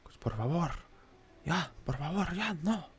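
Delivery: high put-on Mexican Spanish voice; mock Mexican accent. Mexican accent while joking on a trading stream. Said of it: "trying to get my Mexican accent up there".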